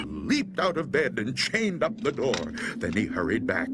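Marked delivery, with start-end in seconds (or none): none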